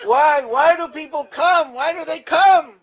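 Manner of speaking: loud, high, slow drawn-out yell; an old man's drawn-out outraged bellow. Retold or imitated. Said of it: "He's yelling".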